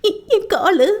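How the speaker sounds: high, breaking voice as the sob starts; bursting into tears. A narrator's reading. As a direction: let the cry break through the words. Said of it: "சொல்லும் போதே அழுகை வெடிக்க".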